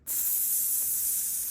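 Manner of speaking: imitated hiss of air leaking out of a tire; soft hissing tire leak. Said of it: "you hear something like".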